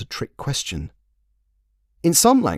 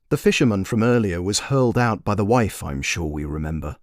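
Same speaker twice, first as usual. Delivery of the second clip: plain reading voice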